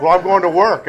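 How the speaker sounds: loud laughing quote of her heading to work; girlfriend leaving, laughing. Retold or imitated. Laughing loudly as he retells her leaving for work. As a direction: laugh it out, mocking her morning goodbye. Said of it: "she'd say".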